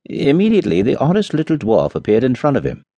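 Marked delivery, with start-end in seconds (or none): none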